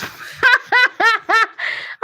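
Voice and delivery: high burst of laughter; laughing. Real, not acted. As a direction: crack up at the chat. Laughing at chat message.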